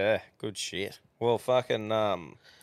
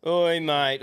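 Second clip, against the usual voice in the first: higher, louder hail; mock bloke greeting; 7 semitones higher, 4 dB louder than usual. Mate greeting his mate, higher and louder hail. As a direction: call across the pub at a mate. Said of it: "listen to him, mate".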